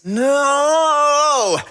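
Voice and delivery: a loud, high, anguished cry of no; dismayed shout. Acted. Kraglin finding the cupboards empty, anguished shout. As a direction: wail it out like the cupboard betrayed you.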